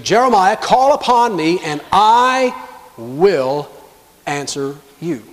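slow down and separate "I... will... answer you"; emphatic. Acted. Preacher repeating God's promise to Jeremiah, emphatic. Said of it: "It's emphatic".

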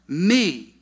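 "me" set apart after a pause, drawn out; emphasis on "me". Shown as a demonstration. Preacher quoting Paul's personal pronoun, emphatic. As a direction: land on "me" by itself. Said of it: "emphasis, personal pronoun".

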